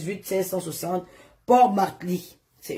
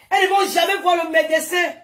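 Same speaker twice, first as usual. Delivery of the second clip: raised, indignant shout; indignant outburst; 8 semitones higher than usual. Real, not acted. Ranting at absent women.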